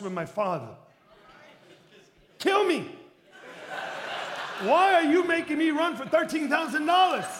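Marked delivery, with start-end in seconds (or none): 2.4-7.3 loud, high comic outburst; exasperated complaint. Retold or imitated. Pastor alone complaining to his Father, loud mock-outraged protest. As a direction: wail in protest, playing up the outrage. Acted complaint to God in office.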